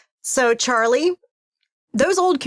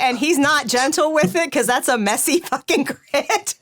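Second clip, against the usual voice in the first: higher pitch, laughter bursting through; breaking into laughter; 5 semitones higher than usual. Real, not acted. Game master explains the rough toss, cracking up laughing. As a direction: start amused and crack up by the end.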